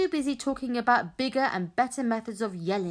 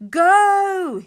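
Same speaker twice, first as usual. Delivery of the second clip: high, very loud long yell; yelled battle cry; 10 semitones higher, 13 dB louder than usual. A narrator's reading. Boys yelling back their leader's command, full yell. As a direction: holler it back as a war cry. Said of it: "yelled back the boys".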